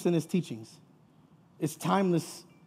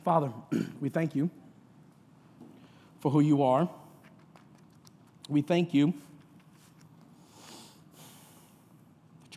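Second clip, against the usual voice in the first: prayer. Real, not acted. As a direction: lead the congregation reverently in prayer. Let's pray.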